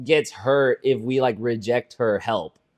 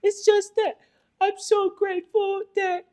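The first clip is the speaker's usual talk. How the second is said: very high, drawn-out crying imitation; mock tearful gratitude; 3 dB louder, slower than usual. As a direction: wail it slowly in a squeaky, exaggerated parody. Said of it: "Celeste was crying and was like".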